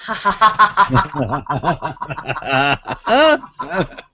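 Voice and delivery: low bowing laugh like a namaste greeting; greeting laughter. Shown as a demonstration. Teacher greeting Terry: low namaste laugh. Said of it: "Greeting laughter".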